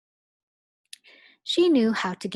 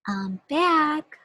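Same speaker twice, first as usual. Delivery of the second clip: higher, bright return; a light hello; cheerful teacher; 8 semitones higher, 4 dB louder than usual. Acted. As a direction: Miss Nelson returning. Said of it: "she said".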